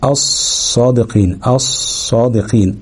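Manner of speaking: الصادقين said slowly with a doubled ص; الصادقين بصاد مشددة ببطء; slow recitation demo. Shown as a demonstration. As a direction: draw out الصادقين with a heavy doubled ص. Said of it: "ندغمها".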